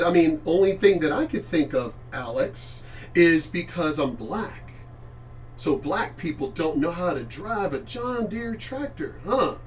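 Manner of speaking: ordinary talk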